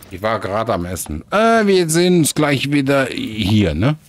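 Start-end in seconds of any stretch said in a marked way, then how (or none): none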